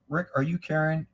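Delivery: ordinary talk, nothing marked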